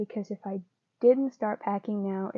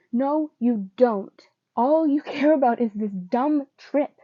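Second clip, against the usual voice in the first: screaming; 3 semitones higher, 7 dB louder than usual. Acted. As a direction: yell it at him in anger. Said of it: "He screamed".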